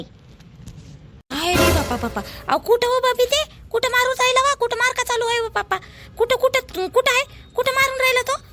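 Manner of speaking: high, loud, frantic asking; alarmed questions. Acted. Neighbour frantically asking where the boy is being beaten. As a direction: panic about where the fight is.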